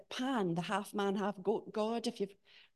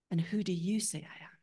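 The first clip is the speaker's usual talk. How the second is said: whispered question. Acted. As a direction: breathe the question like a secret. Jesus's question to disciples.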